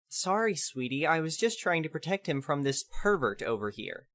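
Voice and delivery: fake-sweet and hushed; saccharine soft. Lady feigning sweetness.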